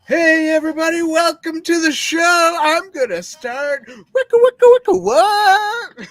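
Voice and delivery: high, loud, stretched-out DJ intro shout; mock club DJ with scratch noises; hype DJ. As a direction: hype up the crowd, drawing out the words. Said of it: "Dan looks like a D J".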